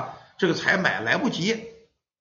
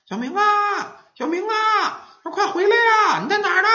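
calling out; 4 dB louder than usual. A narrator's reading. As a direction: call out high, as if from outside. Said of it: "他爸他妈就嚷他".

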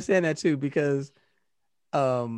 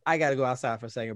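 rushed; 4 semitones lower, 4 dB quieter, much faster than usual. Retold or imitated. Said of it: "I got up from the table".

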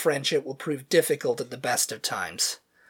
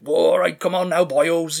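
loud, high Welsh sergeant major bark; booming Welsh sergeant major; 5 semitones higher, 9 dB louder than usual. Retold or imitated. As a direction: boom it out like a Welsh drill sergeant. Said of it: "deep Welsh booming voice".

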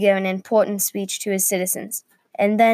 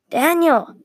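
higher, firmer call of the name; louder call; 8 semitones higher, 4 dB louder than usual. A narrator's reading. Unknown voice tries to rouse Daniel, louder call. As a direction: raise it, short of a yell. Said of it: "louder this time".

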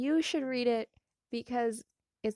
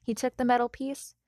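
calm storytelling read; story narration; 6 dB louder than usual. A narrator's reading. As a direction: read it like an audiobook. Reads fanfic aloud.

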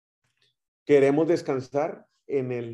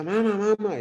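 put-on child yelling; 8 semitones higher than usual. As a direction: call out like a kid shouting for mom. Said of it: "y le grita".

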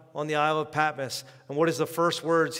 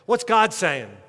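loud question; 5 semitones higher, 5 dB louder, slower than usual. Acted. Preacher repeats question, loud then hushed.

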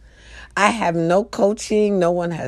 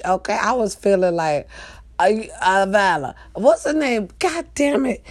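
ordinary talk, nothing marked

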